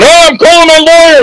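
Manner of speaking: high, alarmed crowd voice, hammed up; mock panic. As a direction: play a startled guest grabbing for the phone. Crowd panics at fake arrest.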